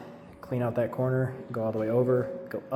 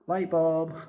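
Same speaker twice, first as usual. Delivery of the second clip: higher, louder light-bulb exclamation; eureka moment; 7 semitones higher, 6 dB louder than usual. Acted. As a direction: announce the bright idea like it just hit you. Sudden idea, exclaimed.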